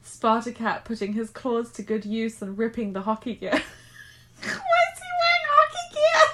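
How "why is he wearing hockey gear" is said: high, loud giggling question; squeaking through laughter; laughing question. Real, not acted. Breaks down laughing at text.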